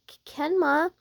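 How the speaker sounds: high, nervous stammer; flustered stammer. Acted. Startled by his wandering hands.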